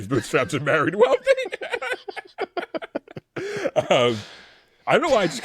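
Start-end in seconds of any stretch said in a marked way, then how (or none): 1.2-3.8 high laughing burst. Real, not acted. Laughing at own joke.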